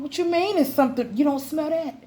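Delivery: high, fast mock disbelief; incredulous sass. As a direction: act shocked they can't smell it. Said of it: "Is something wrong".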